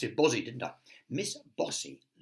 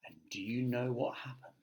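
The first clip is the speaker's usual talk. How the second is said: plain reading voice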